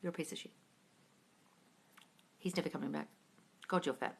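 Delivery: low, very soft self-critical muttering; muttered inner voice. Acted. Coach acts out thoughts during silent sitting, low inner voice. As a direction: drop low and quiet, like a thought creeping in.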